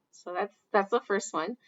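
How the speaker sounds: ordinary talk, nothing marked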